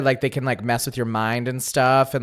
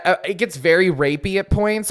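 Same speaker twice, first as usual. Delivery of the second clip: usual speaking voice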